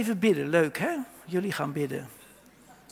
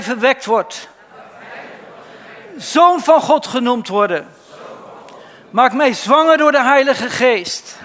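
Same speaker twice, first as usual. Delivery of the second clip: loud prayer; 3 semitones higher, 9 dB louder than usual. Acted. Preacher leads the congregation in prayer, loud and fervent. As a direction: preach the prayer out at full force for the congregation to repeat. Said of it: "Zeg maar".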